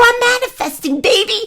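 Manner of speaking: high, laughing sing-song brag; playful high triumph; gleeful boast. Retold or imitated. Gleeful retort to husband.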